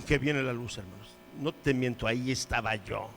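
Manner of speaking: ordinary delivery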